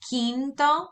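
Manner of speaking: "quinto" begun with a clear "kee" sound, pitched high; qui at the start. Shown as a demonstration. Teacher gives students a memory aid, "quinto" with clear high qui. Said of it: "it has the word qui in the beginning".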